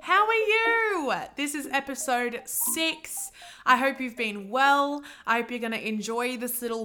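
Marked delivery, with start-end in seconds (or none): 0.0-1.1 sing-song greeting. Real, not acted. Greeting listeners.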